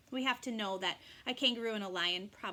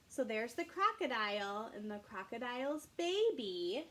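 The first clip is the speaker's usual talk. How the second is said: ordinary delivery, nothing marked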